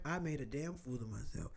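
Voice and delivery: ordinary talk, nothing marked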